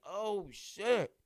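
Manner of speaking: shocked exclamation. Retold or imitated. Recalling shock at the scene.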